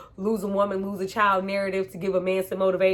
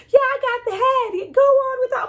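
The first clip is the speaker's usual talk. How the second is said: mock girl's line; 6 dB louder than usual. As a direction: squeak out the line like a brave girl. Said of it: "the girl's like".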